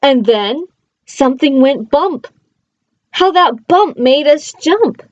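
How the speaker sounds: lively read-aloud, playful bounce on the rhymes; reading to children. Acted. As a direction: read it like a bedtime picture book. Reading a picture book aloud.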